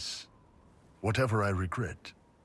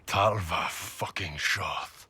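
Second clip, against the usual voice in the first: slow, each syllable hammered; defiant emphasis; slower than usual. Acted. Iron Bull to the Inquisitor, defiantly drawing out his identity. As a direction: spit out "Talva fucking Shoth" slowly with emphasis.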